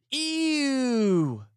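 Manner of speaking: high, squealed ew of disgust; grossed-out squeal. Real, not acted. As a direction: recoil from the picture with a high ew. Disgusted at spider photo.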